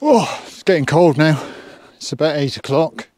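normal talking voice

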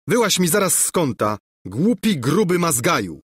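mildly heated insult; angry calling out. A narrator's reading. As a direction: order him out with indignation. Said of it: "zawołał Lulejka".

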